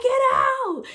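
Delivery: very loud, high-pitched scream to leave; acted-out yell. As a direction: shriek it as if pushing in labor. Acted yell at friends walking in.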